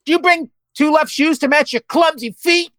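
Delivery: high, loud exclaimed taunt; exclaiming. Acted. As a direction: fire off the insult. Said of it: "John exclaimed".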